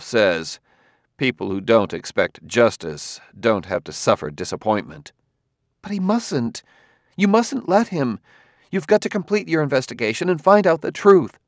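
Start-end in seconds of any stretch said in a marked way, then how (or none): 5.8-11.4 high, urgent pleading voice; Gladia, urgent. A narrator's reading. Gladia urges Bailey to finish the investigation, urgent. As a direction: plead hard, pitched well up.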